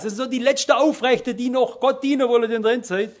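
ordinary delivery

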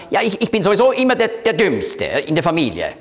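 rushed, high-pitched pout dropping at the end; mock sulking. Sulker complains about being the family's dumbest, mock sulking. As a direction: act out a huffy family sulker, quickly. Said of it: "Das ist Schmollen".

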